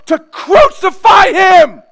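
loud, slow angry shout; shouting crucify. Retold or imitated. Mob turning on Jesus, shouting. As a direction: hurl it like a turned mob. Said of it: "they can go from saying".

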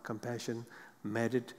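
normal talking voice

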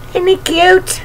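usual speaking voice

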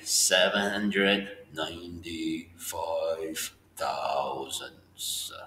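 drawn-out "Seven hundred ninety-five thousandths", each word stretched; teacher standing in for an edited slow-motion replay, stretching every word; very slow reading. Shown as a demonstration. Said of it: "I'll say it like this".

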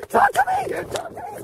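very high-pitched pleading cry; desperate high shriek. Acted. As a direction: shriek the demand in falsetto. Desperate yelling after ducks.

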